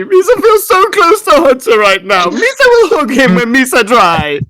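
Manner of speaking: tiny creature gushing with happy tears; Ribbit's voice. Acted. Frog creature Ribbit gushing about Hunter, very high squeaky.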